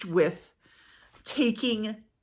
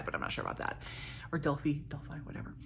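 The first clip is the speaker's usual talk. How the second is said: muttered aside; 3 semitones lower, 11 dB quieter, faster than usual. Real, not acted.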